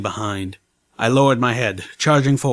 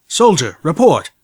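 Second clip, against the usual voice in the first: barked command; 5 semitones higher, 6 dB louder than usual. Acted. Commanding order.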